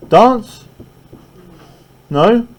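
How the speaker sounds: high, rising coax; playful teacher nudge; coaxing question. Shown as a demonstration. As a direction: urge them up to dance. Urging students to dance.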